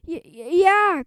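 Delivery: put-on a frightened boy backing up his friend; nervous stuttered yes; scared stutter. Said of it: "cried Harold".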